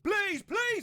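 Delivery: high, loud, desperate plea; panicked pleading. Acted. Artist who pasted wrong thing to client, panicked pleading. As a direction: beg in hammed-up panic.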